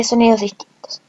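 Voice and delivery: usual speaking voice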